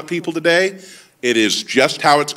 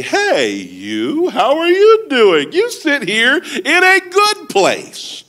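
high, drawn-out gushing greeting; fawning welcome; 11 semitones higher, much slower than usual. Acted. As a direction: gush over an honoured guest, slowly. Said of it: "and say".

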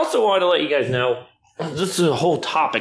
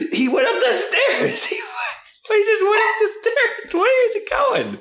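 high pet voice with a laugh. Real, not acted. Owner laughing as her puppy climbs the stairs, high voice. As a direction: coo at the puppy through laughter.

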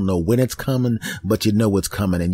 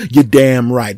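emphatic; 2 semitones higher, 8 dB louder, slower than usual. Retold or imitated. Speaker eagerly answers.

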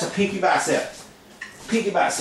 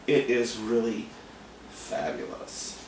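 slow, very low, breathy-free diva purr on fabulous; diva drawl; 8 semitones lower, 7 dB quieter, slower than usual. Pretend. Vlogger admiring his own hair, slow low diva voice. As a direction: savour fabulous like a vain diva.

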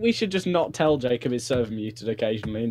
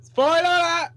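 shouted warning; 13 semitones higher, 3 dB louder than usual. Acted. Warning friends of spoilers.

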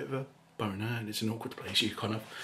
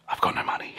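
hushed whispered reply; whispered back; faster than usual. Retold or imitated. Customer whispers to stripper he's broke. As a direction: whisper the bad news back. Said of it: "I whispered back".

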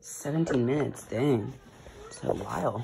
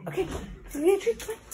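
1 excited pet talk; faster than usual. Real, not acted. Excited treat talk to dogs.

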